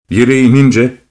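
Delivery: ordinary delivery, nothing marked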